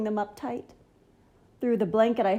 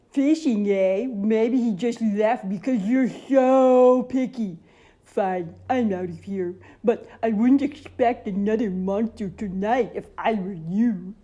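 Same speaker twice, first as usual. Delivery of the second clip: huffy monster; 6 dB louder than usual. Acted. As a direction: storm off in a sulk. Mac sulks and leaves.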